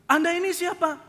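high, loud, affronted challenge; Pak Mukidi. Retold or imitated. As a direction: challenge the stewardess angrily. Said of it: "Pak Mukidi marah-marah". (membentak dengan nada tinggi)

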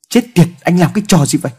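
furious shouted outburst; angry yell. A narrator's reading. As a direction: yell it in outrage. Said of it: "Quyền gào lên".

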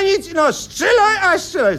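high, urgent command as the wife; mock yell. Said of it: "A ona".

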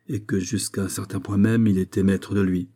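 ordinary delivery, nothing marked

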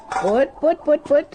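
high sing-song put-put call to the hens; calling chickens. Acted. Farm woman calling her hens at feeding time, high sing-song.

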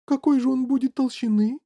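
high-pitched fussing mother; flustered. A narrator's reading. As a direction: ask in a fluster. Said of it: "засуетилась миссис Ти Ви".

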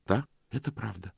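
steady, decided, falling on "это"; a quiet but firm "это правда". A narrator's reading. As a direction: answer with certainty. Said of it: "И она ответила твёрдо".

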